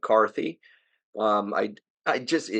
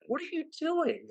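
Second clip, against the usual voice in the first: even higher, softer New Jersey accent, near a squeak; New Jersey accent peak; 7 dB quieter than usual. Real, not acted. Host repeating the complaint in disbelief, high New Jersey accent. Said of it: "my New Jersey accent coming out there".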